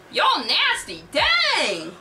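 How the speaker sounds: high, loud cry of disgust; disgusted outburst. A narrator's reading. Tony leaves the shared bathtub, loud disgust. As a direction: holler it, appalled.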